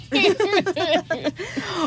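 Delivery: laugh. Real, not acted. Laughs at own ay.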